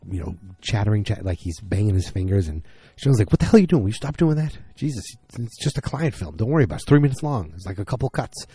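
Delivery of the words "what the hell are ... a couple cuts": retold irritated boss, higher pitch; imitating an exasperated boss; exasperated telling-off. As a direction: scold a fidgeter in a raised pitch. Boss snaps at nervous fidgeter.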